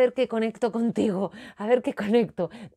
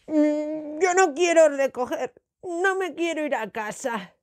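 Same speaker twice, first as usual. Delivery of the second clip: put-on higher-pitched child's protesting whine; sulky little Moncho; 6 semitones higher than usual. Moncho refusing to tidy up, whining. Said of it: "y decía".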